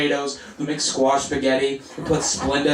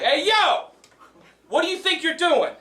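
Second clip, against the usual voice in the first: much higher-pitched confrontational call; mock aggressive challenge; 14 semitones higher than usual. Man confronts comedian at the train stairs, mock aggressive. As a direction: challenge them like a stranger coming at you. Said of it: "comes at me very aggressively".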